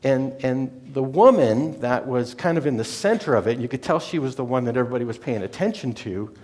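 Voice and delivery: usual speaking voice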